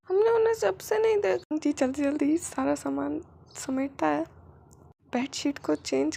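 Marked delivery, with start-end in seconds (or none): 0.1-1.6 put-on higher, childlike complaint; child's voice. Child telling the uncle about parents, childlike. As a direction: speak like a worried kid.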